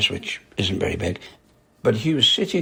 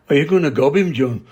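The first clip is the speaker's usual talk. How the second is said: louder, higher imitation of a broad Scottish accent; 5 semitones higher, 6 dB louder than usual. Geordie asking his mate about the threat, imitated broad Scottish accent. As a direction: put on a broad Scots voice for the question. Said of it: "In his broad Scottish accent".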